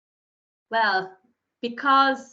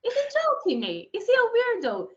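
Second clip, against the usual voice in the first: baffled; 13 semitones higher, 3 dB louder than usual. Retold or imitated. Retelling inner puzzlement.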